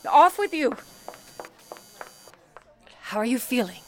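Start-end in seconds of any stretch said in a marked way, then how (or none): 0.1-0.8 higher, louder dismissal; brisk send-off. Acted. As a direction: shoo her out with a push. Supervisor sends operator off.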